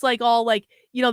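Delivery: usual speaking voice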